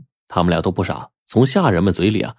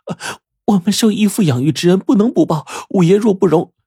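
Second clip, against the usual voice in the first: put-on high, strained young woman's plea; acted frightened confession, pitched way up; alarmed pleading; 13 semitones higher than usual. A narrator's reading. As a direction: plead urgently on your knees. Sisters kneel, pale, pleading.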